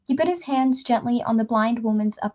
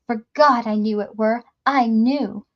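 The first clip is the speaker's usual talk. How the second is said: plain reading voice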